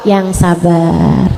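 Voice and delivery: low, long drawn-out consolation; patient soothing. Acted. Ustazah answering a mistreated daughter-in-law, slow soothing. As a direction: stretch it out slowly to calm them. Soothing reply to complaining women.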